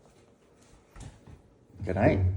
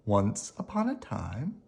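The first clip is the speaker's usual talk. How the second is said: ordinary delivery, nothing marked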